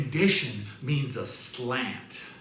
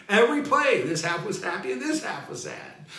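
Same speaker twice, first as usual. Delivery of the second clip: Every play higher and louder, then settle; louder retake; 7 semitones higher, 4 dB louder, faster than usual. Shown as a demonstration. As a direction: call it out to the crowd.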